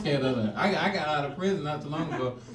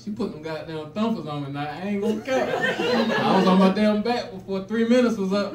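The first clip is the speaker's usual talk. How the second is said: ordinary talk, nothing marked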